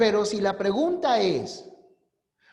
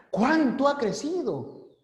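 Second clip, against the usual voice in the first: "¿Cuánto ha crecido?" pitched higher in amazement; returning home, amazed by the little tree's growth; surprised exclamation; 7 semitones higher than usual. Shown as a demonstration. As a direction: marvel at how tall the tree has grown. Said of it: "O puedes mostrar sorpresa".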